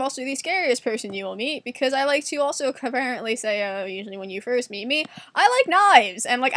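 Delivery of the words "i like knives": loud, high-pitched announcement; hammed-up eager blurt. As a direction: blurt it out to a new acquaintance. Acts out blurting it to strangers.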